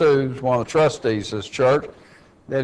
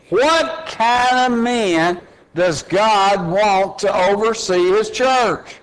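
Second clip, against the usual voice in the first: higher, impassioned pulpit voice, reading the qualifications; fiery preaching; 6 semitones higher than usual. Acted. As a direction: build the sermon, drive each line home. Preaching continues at full force.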